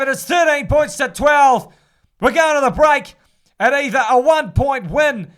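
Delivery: loud, high shout for the try; excited try call. Acted. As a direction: lift the call as the winger scores. Try scored, commentator calls it.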